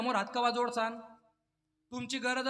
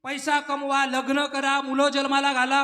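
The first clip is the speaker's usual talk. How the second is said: building exhortation; 3 semitones higher, 9 dB louder than usual. Real, not acted. Preacher urging householders to do everything, building to a shout. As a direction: drive each item harder, peak on the last word.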